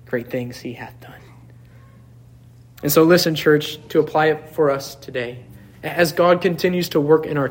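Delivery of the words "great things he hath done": whispered hymn line. Acted. Quoting hymn softly.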